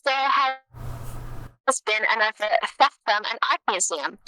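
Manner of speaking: mock news anchor reading. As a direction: read it like a radio bulletin. Reads a made-up news bulletin.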